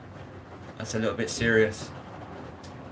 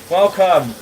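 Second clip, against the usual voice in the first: higher, louder welcome to the stream; excited welcome; 6 semitones higher, 8 dB louder than usual. Real, not acted. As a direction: greet the viewers with a burst of energy. Streamer greeting viewers.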